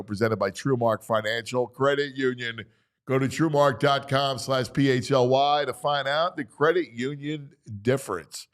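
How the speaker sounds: usual speaking voice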